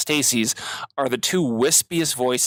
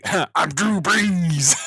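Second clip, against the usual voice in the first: orc in a Drew Brees jersey; 4 semitones higher, much slower than usual. Pretend. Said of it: "it's like".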